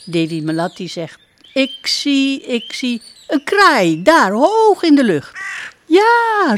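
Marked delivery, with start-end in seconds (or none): 1.5-5.4 put-on higher, louder excited girl; thrilled to spot it; excited spotting. Princess pointing out a crow, higher and louder. 5.9-6.6 very high, louder duck yes; duck's cry. Acted. Duck crying yes to the princess, very high and loud. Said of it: "roept Boeng Bebek".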